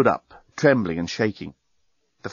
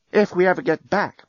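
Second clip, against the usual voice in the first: put-on lifted higher, a wistful young woman; Lucy's voice; 7 semitones higher than usual. A narrator's reading. Lucy dreaming of the rebuilt hotel, lifted higher. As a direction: voice Lucy a touch higher and hopeful. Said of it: "agreed Dan".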